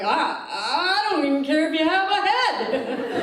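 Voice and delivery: high, loud, building mock ad; hammed-up ad read. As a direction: escalate the absurd list. Escalating mock ad.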